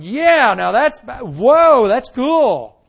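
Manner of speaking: loud, wide-eyed retold exclaiming; mock-awed loud exclaim; amazed exclaiming. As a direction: blurt it out like a stunned young man. Retelling his own amazed reaction.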